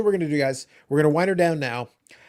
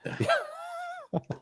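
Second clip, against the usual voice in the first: high laugh; 11 dB quieter than usual. Real, not acted. Laughing at his own joke.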